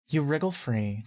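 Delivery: ordinary delivery, nothing marked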